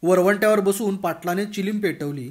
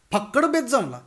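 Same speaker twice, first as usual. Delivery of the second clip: ordinary delivery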